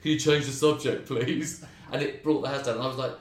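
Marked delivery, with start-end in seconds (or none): 0.0-1.6 louder, higher mock repeat of the request; heckler's words echoed back louder to mock him. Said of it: "somebody else chimed in with".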